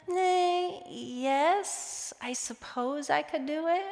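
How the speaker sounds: high, soft, dragging half-hearted yes; grudging mock yes; reluctant yes. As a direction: sigh it out like you'd rather not. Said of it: "sometimes maybe it's just a".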